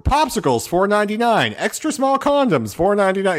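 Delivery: high-pitched supermarket checkout call; checkout price caller. Retold or imitated. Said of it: "being at those checkout things".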